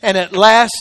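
ordinary talk

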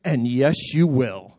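usual speaking voice

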